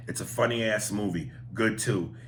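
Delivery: ordinary delivery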